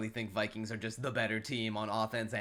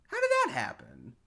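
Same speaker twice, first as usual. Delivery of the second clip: baffled squeak; 3 dB louder than usual. Retold or imitated. Acting the receiver's puzzled reaction.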